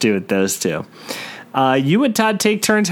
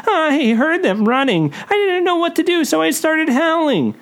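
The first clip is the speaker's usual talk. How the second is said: terrified and shaky; 12 semitones higher than usual. A narrator's reading. Todd answers his friend in a high terrified voice. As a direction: quaver like a frightened kid. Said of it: "in a terrified and shaky voice".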